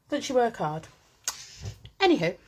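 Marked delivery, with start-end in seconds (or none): none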